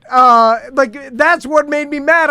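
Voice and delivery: loud, high-pitched annoyed rant; heated rant. Real, not acted. Angry rant about setup.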